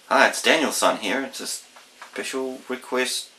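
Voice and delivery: ordinary delivery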